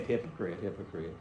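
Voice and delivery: soft, low self-mocking hypocrite; quiet rueful self-rebuke; self-mocking mutter. Real, not acted. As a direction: scold yourself under your breath. Preacher mocking himself.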